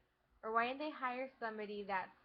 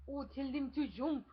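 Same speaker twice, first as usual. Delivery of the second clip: put-on lilting Indian-accented English, louder; mock Indian accent; 3 semitones higher, 6 dB louder than usual. Imagined Dora from India urges the kids, in a mock Indian accent. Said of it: "Do their accent".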